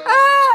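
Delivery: sudden high yelp of fright; startled scream. Real, not acted. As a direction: shriek at the jump scare. Jump scare in the video.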